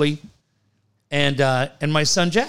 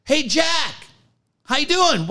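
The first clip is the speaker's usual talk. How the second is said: high-pitched call across the pool; imitated kid's yell; 10 semitones higher than usual. Water polo kid greeting his friend, imitated yell. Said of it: "one of the kids from the water polo team yells".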